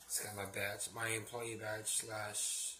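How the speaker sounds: ordinary talk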